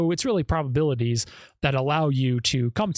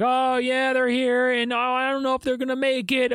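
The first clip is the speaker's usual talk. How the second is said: higher mock-casual caller voice; half-hearted mock phone report; mocking his 911 call; 8 semitones higher than usual. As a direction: lampoon an unbothered husband on the phone. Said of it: "saying, like".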